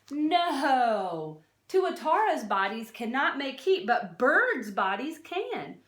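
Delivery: ordinary talk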